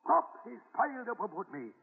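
high, rousing storyteller's voice building the adventure; dramatic tall-tale telling. Acted. Grandpa thrilling his grandson with a tall tale, high and rousing. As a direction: sell the war story like a hero.

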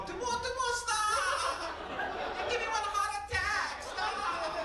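high panicked mom voice; scared mom. Retold or imitated. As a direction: shriek it like a frightened mother. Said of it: "used to scare my mom".

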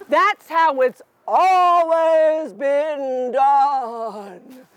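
very slow, fussy insect voice; Cricket voice. Acted. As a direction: drag it out like a pompous cricket. Cricket insists on tradition.

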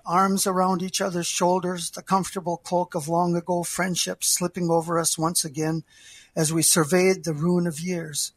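usual speaking voice

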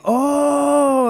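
long, high-held taunting "oh"; mocking taunt. Cops taunting prisoners through the windshield, mocking. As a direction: crow it like someone caught them red-handed. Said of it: "the cops are taunting us through the windshield saying".

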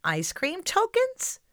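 surprised question. Acted. Host echoes story in surprise.

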